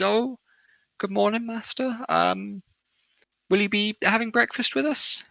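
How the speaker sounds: put-on high-pitched young serving woman. Kitchen woman greeting the master, young woman's voice. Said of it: "greets you and says".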